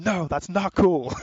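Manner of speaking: loud, high-pitched laughing protest; slow, drawn-out mock telling-off; mock scolding. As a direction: protest loudly, half-amused. Acting Logan scolding the girl.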